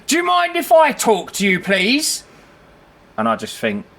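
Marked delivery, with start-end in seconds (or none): 0.1-2.2 high, louder put-on prissy voice; mocking the landlord. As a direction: mimic him mincingly, pitched way up. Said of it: "He goes".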